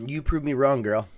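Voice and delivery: loud, delighted admission; loud excited. Real, not acted. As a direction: concede it loudly with glee. Telling Allison she was lucky.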